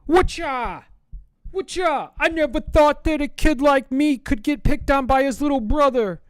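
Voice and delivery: high, loud sing-song whine; hammed-up high sweet voice; sweet mock reply. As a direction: play the picked-on innocent. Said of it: "I just parry it with kindness".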